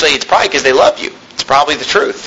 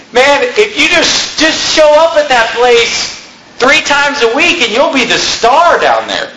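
higher, slow mock advice voice; mock churchgoer; 8 semitones higher, slower than usual. Preacher imitating people who recommend a dead church, higher and slower. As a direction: imitate someone touting an easy church. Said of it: "I've heard people talk like this".

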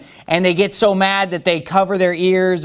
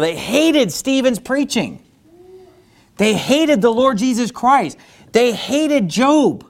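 preaching at full force; 7 semitones higher, slower than usual. Acted.

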